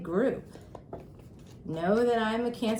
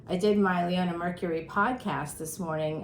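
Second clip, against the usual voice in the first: normal talking voice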